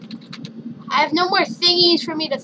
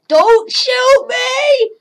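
panicked scream; 10 semitones higher, 5 dB louder, slower than usual. Acted. Panicked plea in game.

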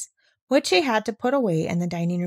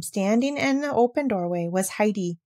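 ordinary delivery

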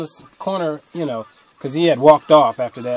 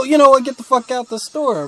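put-on higher, louder exasperated snap at the girl; irritated outburst; 10 semitones higher, 4 dB louder than usual. Cashier telling off a teen girl, irritated outburst. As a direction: let her have it, half-joking. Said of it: "it kinda made me go".

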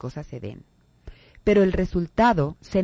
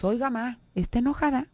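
higher cautious question; timid child; 7 semitones higher than usual. Retold or imitated. Child asking his angry mother, cautious and higher. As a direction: ask carefully, testing her mood. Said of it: "le decimos".

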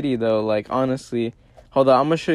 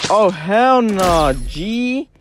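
drawn-out disbelief; 6 semitones higher, 4 dB louder, much slower than usual. Real, not acted. Near death in fight.

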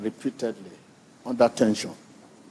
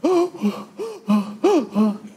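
mock gasp; 14 semitones higher than usual. Mock waking in shock.

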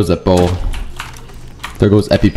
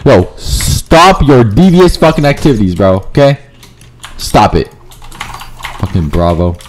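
ordinary talk, nothing marked

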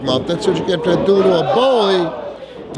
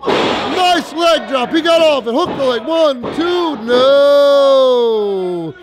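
excited; 5 semitones higher, slower than usual. Real, not acted. Near fall during wrestling match.

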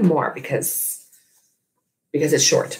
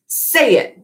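higher, insistent order; commanding; 11 semitones higher, 3 dB louder than usual. Acted. Bob ordering Ivan to say it, a firm command. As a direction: make him say it. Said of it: "Bob commands".

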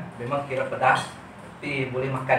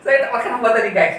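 laughing; 8 semitones higher, 6 dB louder than usual. Real, not acted. Laughing reply, loud.

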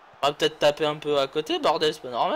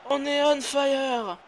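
hyped slow; 8 semitones higher, much slower than usual. Real, not acted.

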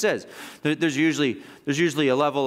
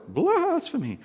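high, stretched-out fake horror; put-on scandalized wail; mock worked up; 10 semitones higher, slower than usual. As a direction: ham up the word, drawing out its first syllable. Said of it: "they're getting all worked up".

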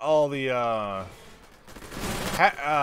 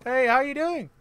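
chirpy greeting; 10 semitones higher than usual. Acted. Greeting a game character.

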